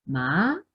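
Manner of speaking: "Ma" rising like a question; second tone. Shown as a demonstration. Teacher showing the second tone as a question, rising "Ma". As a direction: swing "Ma" upward as if asking. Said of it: "es como si fuera una pregunta".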